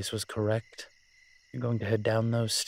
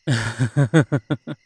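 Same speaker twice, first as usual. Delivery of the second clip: laugh; 3 semitones higher, 9 dB louder than usual. Acted. Handing over the master key.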